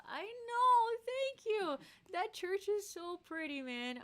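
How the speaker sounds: very high-pitched, delighted gush; real gushing in a high squeaky voice; gushing thanks. Real, not acted. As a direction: squeal it out high, easing down gradually. Gushing thanks over a compliment.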